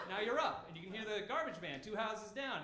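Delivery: ordinary delivery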